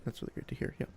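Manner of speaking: soft whispered repeat; whispering. Real, not acted. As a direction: drop to a whisper. Repeats the phrase, now whispered.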